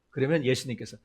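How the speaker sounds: ordinary talk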